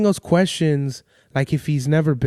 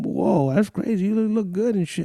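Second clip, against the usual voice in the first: higher-pitched impression of a wowed dad; mock wonder, pitched up; amazed dad; 5 semitones higher, 3 dB quieter than usual. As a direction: imitate dad gushing in amazement. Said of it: "my dad has the craziest reactions".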